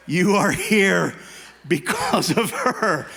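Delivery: slow and earnest; slow, sincere. Real, not acted. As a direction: land it slowly, sincerely. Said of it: "slow sincere point".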